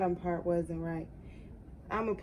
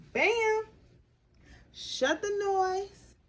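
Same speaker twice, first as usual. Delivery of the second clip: high, drawn-out burst of delight; real excited whoop; thrilled exclamation; 11 semitones higher, 4 dB louder, much slower than usual. Real, not acted. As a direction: cheer it in a high voice, stretching the words. Delighted at how well it turned out.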